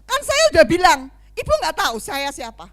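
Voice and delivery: loud, high, offended outburst; indignant refusal. Acted. Proud church member scolding the pastor who recruits her, indignant. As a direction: put them in their place, loud and haughty.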